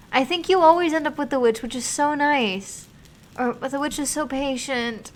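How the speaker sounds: high, loud delighted squeal; excited gush. Real, not acted. Streamer gushing to chat about the witch, high delighted squeal. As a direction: gush over her favourite ending.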